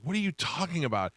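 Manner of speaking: high-pitched mock bewilderment; imitated indignant wife. Wife answering a yelling caller, imitated indignation. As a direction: push the pitch way up, baffled and protesting.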